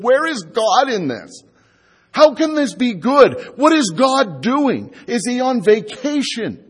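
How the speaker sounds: rising distressed protest; anguished questioning. Retold or imitated. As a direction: cry out the doubts of someone hurting. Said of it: "and said".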